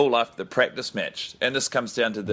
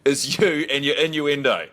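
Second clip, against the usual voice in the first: fed-up hotel manager; 3 semitones higher than usual. Pretend. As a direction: snap at the guest like a manager at the end of his rope. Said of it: "I always want the hotel manager to say".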